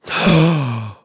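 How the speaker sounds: loud, high gasp of astonishment; shocked gasp. Acted. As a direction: suck in air in big surprise. Guest reacts to fan letter news.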